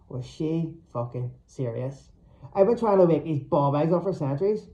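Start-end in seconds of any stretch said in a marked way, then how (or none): none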